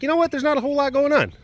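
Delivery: high, quick dismissive voice; airy, high-pitched shrug; breezy quote. Acted. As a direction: toss it off in a high voice. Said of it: "it's just like".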